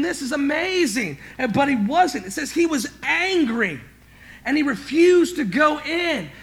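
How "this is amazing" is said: bright delighted exclamation; excited joy. Shown as a demonstration. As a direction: say it as thrilled good news. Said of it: "the response of the older brother should have been".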